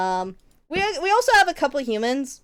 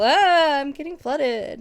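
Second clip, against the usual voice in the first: high mock wail, slow and drawn out; hammed-up meme lament; mock distress; 4 semitones higher, 4 dB louder, slower than usual. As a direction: play up a silly panicked cry. Replays the flooded quote as a joke.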